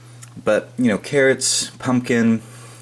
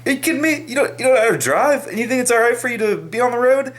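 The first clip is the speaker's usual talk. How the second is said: hammed-up furious disbelief, pitched way up; mock road rage rant. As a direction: act out screaming at bad drivers in the car. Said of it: "get furious at these people".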